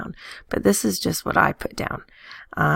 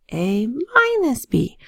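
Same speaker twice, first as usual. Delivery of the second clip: "A minus B" said slowly and much higher; voice goes up; 8 semitones higher, slower than usual. Shown as a demonstration. Teacher showing the sign change, "A minus B" high and slow. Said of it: "make your voice go up higher".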